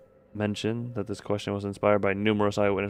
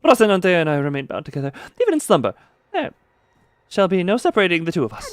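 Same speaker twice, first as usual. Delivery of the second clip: high, loud and fast, rolling Rocinante as rrrosinante; grand knight voice; 7 semitones higher, 5 dB louder, faster than usual. Acted. Streamer voicing Don Quixote declaring loyalty to her shoes.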